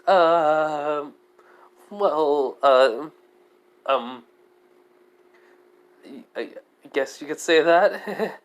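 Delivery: slow, halting, bashful; shy hesitant admission. Acted. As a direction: drag it out bashfully. Shy Belle admits shyness.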